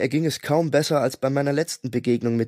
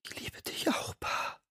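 soft voiceless whisper; 13 dB quieter than usual. A narrator's reading. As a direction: whisper it to him tenderly. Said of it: "flüsterte sie".

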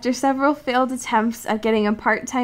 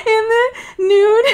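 breaking into helpless laughter mid-line; laughing; 9 semitones higher, 4 dB louder, slower than usual. Real, not acted. Cohost reading aloud, cracking up with embarrassed laughter. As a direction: laugh through the words, higher.

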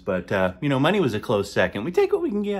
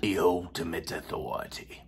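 low, softer, dramatic intonation; ominous low voice; 7 semitones lower, 9 dB quieter than usual. Acted. Comic host darkly promising teachers ultimate power. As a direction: intone it like a dark overlord.

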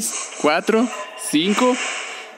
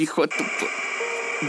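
breathless whispered swear after a scare; startled gamer curses under his breath, whispered; 2 semitones lower, 8 dB quieter, slower than usual. Real, not acted.

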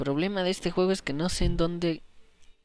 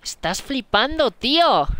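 higher, louder put-on Castilian accent from Spain; exaggerated Spanish-from-Spain accent, pitched up; mock Spain Spanish accent; 10 semitones higher, 6 dB louder than usual. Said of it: "No soy español".

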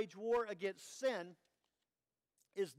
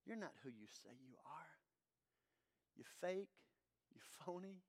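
much lower and far softer, sneering; low hissed taunt; 6 semitones lower, 15 dB quieter than usual. Acted. The devil sneering at the believer, low and soft. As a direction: sneer it low and close, barely voiced. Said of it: "He's gloating".

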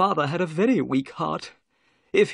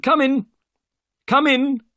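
high, loud summons; calling out; 14 semitones higher, 7 dB louder than usual. A narrator's reading. Host calls visitors in through an open door, calling out. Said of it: "said the voice".

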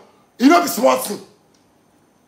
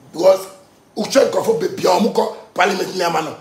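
ordinary talk, nothing marked

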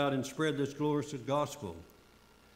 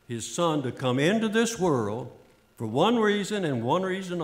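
raised, pressing sermon voice, driving each phrase home; fervent preaching; 5 dB louder than usual. Acted. Preacher pleading with the congregation, fervent preaching.

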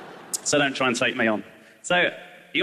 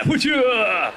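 whoosh sound; 6 semitones higher than usual. Pretend. Kid whipping sock at cat.

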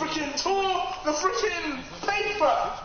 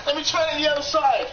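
usual speaking voice

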